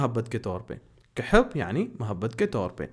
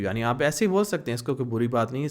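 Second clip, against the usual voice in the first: normal talking voice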